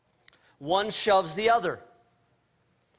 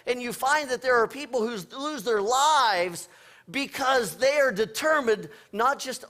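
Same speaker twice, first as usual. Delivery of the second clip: ordinary delivery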